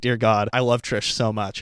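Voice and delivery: ordinary talk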